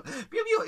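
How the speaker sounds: high rising peeps; chick peeps. Acted. Chicks running out of the henhouse, high peeps. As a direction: peep like a chick darting out.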